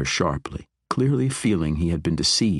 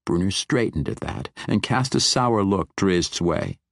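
usual speaking voice

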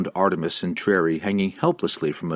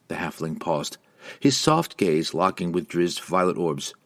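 ordinary delivery

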